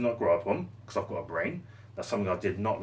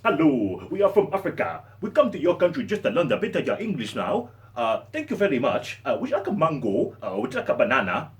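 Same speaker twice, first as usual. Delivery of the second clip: loud, higher mock African accent; caricatured African-English voice, raised and punchy; 4 semitones higher, 5 dB louder than usual. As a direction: put on an exaggerated African accent. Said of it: "African people talk like this".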